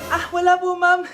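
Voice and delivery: usual speaking voice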